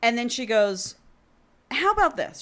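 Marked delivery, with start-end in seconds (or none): 1.7-2.4 cheeky suggestion. Retold or imitated. She pitches her beta a sneaky idea, cheeky. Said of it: "She gets a little cheeky idea".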